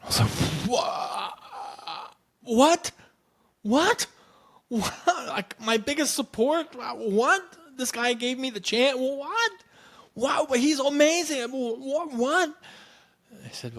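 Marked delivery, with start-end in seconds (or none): 0.7-12.5 very high, squeaky incredulous sputtering; stunned disbelief. Retold or imitated. Teacher learning his biggest supporter got fired, high-pitched disbelief. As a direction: splutter in a comically high voice. Acts out shock at the firing.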